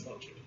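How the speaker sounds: logit with long o, soft g; first syllable of logit like "low", then "jit"; logit pronounced LOH-jit. Shown as a demonstration. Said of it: "the correct way to pronounce it".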